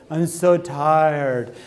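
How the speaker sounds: very slow, drawn-out lazy whine. Retold or imitated. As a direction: drag it out like you can't get off the couch. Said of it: "just be like".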